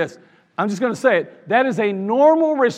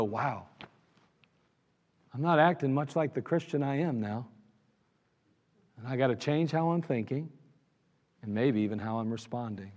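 softer, lower reflective self-talk; quiet self-talk; 7 semitones lower, 10 dB quieter than usual. Retold or imitated. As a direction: muse to yourself, hushed.

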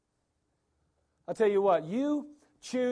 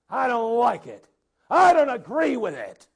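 louder, higher grumbling protest; disgruntled complaint; 4 semitones higher, 8 dB louder than usual. Retold or imitated. Disgruntled Israelites grumbling against Moses, loud. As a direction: protest with force. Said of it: "express yourself quite that enthusiastically".

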